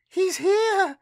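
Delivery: put-on very high, loud, delighted girl; gleefully. A narrator's reading. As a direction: squeal it with glee. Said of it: "she said gleefully".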